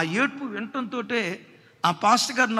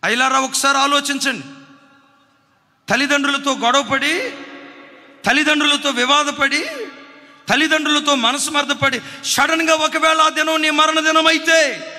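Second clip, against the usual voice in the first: louder, urgent warning to the congregation; preaching at full force; 4 semitones higher, 6 dB louder than usual. Acted. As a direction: hammer each line home. (బిగ్గరగా హెచ్చరిస్తూ బోధ)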